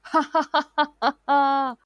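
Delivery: high, loud mocking cackle; gloating laugh. A narrator's reading. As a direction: laugh down at them in triumph. Cat gloats over trapped friends.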